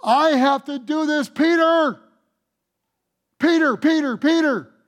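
Jesus insisting to Peter, voiced loud and high; Jesus pleading. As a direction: plead with Peter by name, rising. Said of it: "Jesus said".

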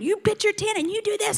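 high-pitched mock bossy orders; child drill sergeant. Granddaughter as drill sergeant orders the campers, mock bossy. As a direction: play a kid ordering everyone about. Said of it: "She's like the drill sergeant".

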